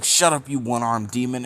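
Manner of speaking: loud angry outburst, hammed-up; pissed-off yell. Kiba snapping at Naruto during the fight, loud and angry. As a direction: spit the insult out loud and furious. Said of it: "he's getting more pissed".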